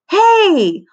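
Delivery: high loud shout of protest; holler. A narrator's reading. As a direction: holler it across the room. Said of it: "hollered Lenny".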